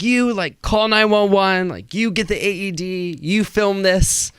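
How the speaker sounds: high, slowed, overblown commands; mock commanding orders. Nurse ordering bystanders around, mock dramatic commands. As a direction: bark out orders like a TV hero.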